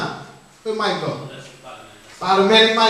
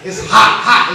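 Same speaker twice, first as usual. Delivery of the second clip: ordinary talk, nothing marked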